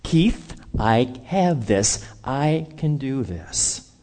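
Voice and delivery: slow, measured assurance; God's voice. Retold or imitated. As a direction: speak as a calm God taking charge. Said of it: "God is saying".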